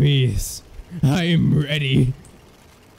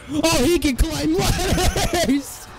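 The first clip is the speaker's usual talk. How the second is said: laughing disbelief; 10 semitones higher, slower than usual. Real, not acted.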